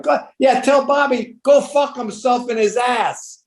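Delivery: high, slow yelled brush-off; mock shout. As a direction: yell the brush-off across the busy restaurant. Pesci's dismissal of De Niro.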